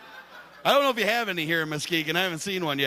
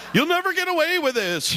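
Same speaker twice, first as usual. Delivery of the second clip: much higher hammed-up villain line; mock villain; 11 semitones higher than usual. Host taunting his tied-up buddies, mock villain, much higher. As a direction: ham up a cartoon villain taunt, high-pitched. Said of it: "And I'm like".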